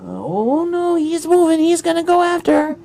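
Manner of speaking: very high, alarmed little voice; Moss Mouse. Acted. Mouse warns Yuvi about Griff, high-pitched and alarmed. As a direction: squeak out an urgent warning. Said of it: "Moss Mouse tells you".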